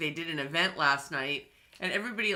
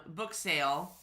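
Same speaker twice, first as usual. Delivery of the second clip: usual speaking voice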